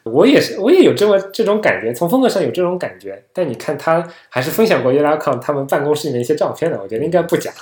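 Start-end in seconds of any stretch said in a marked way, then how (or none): 0.0-3.2 excited agreement. Real, not acted. Eager agreement after laughing.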